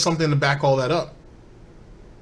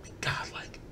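breathy whispered tag; whispered gangster aside; 17 dB quieter than usual. Acted. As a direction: hiss it quietly for effect. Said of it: "same tone as the mobster from, uh, Home Alone".

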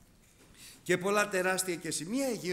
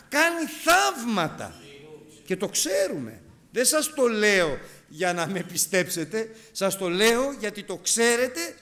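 loud, rising, slow proclamation; full-force preaching; 4 semitones higher, 8 dB louder, slower than usual. Real, not acted. Preacher to congregation, loud fervent proclamation.

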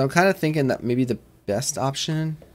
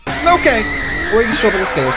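sudden high yelp, then loud and higher rattled talk; startled shout; 10 semitones higher, 8 dB louder than usual. Real, not acted. Gamer startled by the monster on the stairs, loud rattled shout. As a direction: yell as the monster pops up.

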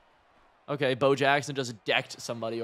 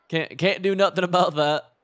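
louder and higher, laughing it off; excited disbelief; 5 semitones higher, 7 dB louder than usual. Real, not acted. Gamer shrugging off opponent's touchdown, excited. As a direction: throw your hands up out loud.

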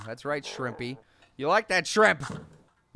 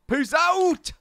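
high, drawn-out sign-off; catchphrase sign-off; 8 semitones higher than usual. Pretend. Host signing off to viewers, high drawn-out catchphrase. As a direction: stretch it out high and playful.

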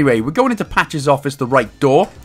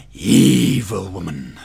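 low, drawn-out mock loathing; mock menace; 8 semitones lower, much slower than usual. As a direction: stretch it slowly and low with hammed-up dread. Mock disgust at Margaret Thatcher.

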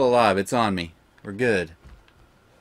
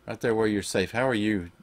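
tuck the pet in gently; tender, soothing; 2 semitones lower, 5 dB quieter than usual. Real, not acted. Gamer settling his pet down, soft and tender.

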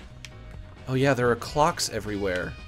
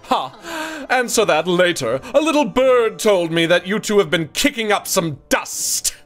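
put-on mid-Atlantic accent; 5 semitones higher, 4 dB louder than usual. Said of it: "She would definitely have a mid-Atlantic accent".

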